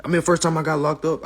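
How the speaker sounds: normal talking voice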